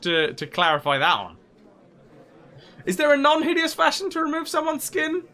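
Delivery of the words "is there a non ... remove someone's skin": squeaky, disbelieving question; high incredulous voice. Real, not acted. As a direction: ask in a high, baffled voice.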